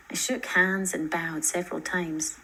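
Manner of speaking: ordinary delivery, nothing marked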